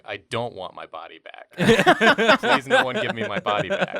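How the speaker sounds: slow, loud whiny plea; mock scream. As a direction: wail it out pleading. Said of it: "he goes around screaming".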